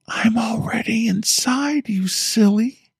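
put-on high, slow, whispered little girl taunt; little girl's whisper. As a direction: whisper it like a creepy child, slowly. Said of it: "A little girl's whispering voice".

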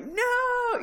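long, high-pitched mock scream; retold scream. Viewer retelling how they screamed at the TV, drawn-out high mock scream. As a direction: stretch out a high wail of protest. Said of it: "screamed at the T V".

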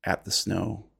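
ordinary delivery

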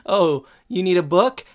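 high, eager offer; naive Corey. Retold or imitated. Corey missing Topanga's hint, high and eager. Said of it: "And Corey says".

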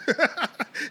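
high giggling burst; hysterical laugh. Real, not acted. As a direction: crack up laughing. Laughing at own story.

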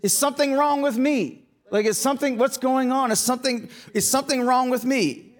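high, bewildered questioning of himself; retold frustration, voice lifted high; frustrated self-questioning. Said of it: "that's where I was like".

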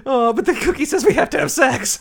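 high, put-on pleading voice; high mock protest; hammed-up cartoon reaction. Acts out Simpsons character's reaction.

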